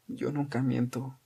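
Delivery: very soft, slow, voiceless-sounding protest; weak, mildly indignant; hoarse mock indignation. A narrator's reading. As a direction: feign offence with hardly any voice. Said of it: "Su amiga estaba afónica, pero se las arregló para fingir indignación".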